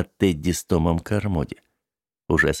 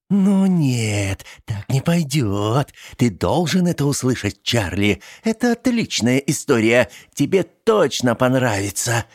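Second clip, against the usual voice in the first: slow hypnotic serpent hiss; 6 semitones higher than usual. Acted. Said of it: "Словно змея прошипела она".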